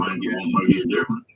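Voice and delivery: high faint echoed repeat; garbled echo through the line; audio echo. Real, not acted.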